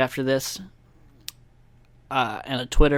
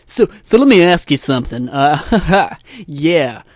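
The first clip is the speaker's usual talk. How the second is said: loud, raised mock DJ patter; radio host; 3 semitones higher, 7 dB louder than usual. Podcaster mocking radio hosts, put-on DJ voice. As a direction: put on a chummy host voice with a chuckle. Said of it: "I couldn't be a radio host".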